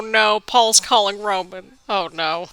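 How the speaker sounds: ordinary delivery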